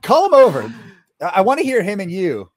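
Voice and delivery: delighted, egging him on; excited praise. Real, not acted. Cohost delighted by the impression, excited. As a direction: cheer the impression on. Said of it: "Tai Lopez is so good".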